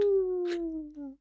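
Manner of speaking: high, howling wolf call. Acted. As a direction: howl it out like a wolf. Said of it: "fucking howling".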